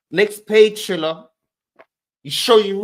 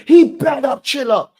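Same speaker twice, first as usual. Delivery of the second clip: mock tough; 11 semitones higher, 7 dB louder than usual. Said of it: "That's the energy Beezy gave me".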